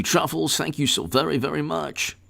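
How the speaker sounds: ordinary delivery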